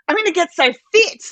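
high, loud and fast; excited. Retold or imitated. Mother cheering about the hills, high and fast. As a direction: gush about getting fit. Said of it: "you know, like".

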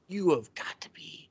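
breathless whispered disbelief; whispering. Retold or imitated. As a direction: whisper it in exasperation. Retold muttered disbelief.

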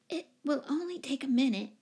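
high, timid apology; apologetic. A narrator's reading. Timid tenant placating her commanding neighbour, apologetic. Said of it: "apologized Miss Giddings".

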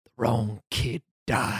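low, slow and deliberate; grave, drawn-out verdict; solemn accusation. Retold or imitated. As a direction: say it heavily, word by word. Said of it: "might as well say".

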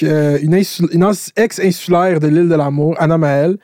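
ordinary delivery

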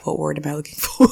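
fast, laughing through "what word am I looking for"; laughing search. Real, not acted. Host stuck on a word, laughing fast. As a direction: rush the line, giggling. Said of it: "I'm so embarrassed".

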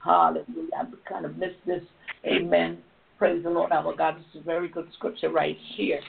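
normal talking voice

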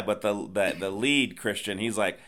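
normal talking voice

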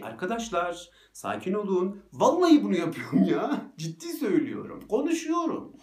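playfully pleads for calm, half joking; mock soothing. A storyteller joking while calming down dogs, mock soothing. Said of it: "Böyle dalga geçiyorum kendimle".

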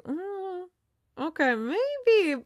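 high, drawn-out reluctant "maybe"; hesitant, stretched-out second thoughts; doubtful hedging. Retold or imitated. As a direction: imitate someone slowly conceding a point. Voices players' growing doubt.

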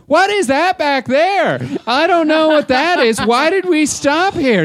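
put-on very high, loud, slow caricature of an older woman; loud Barb. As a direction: butt in loudly as a clueless tourist. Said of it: "just talked loudly through all the things".

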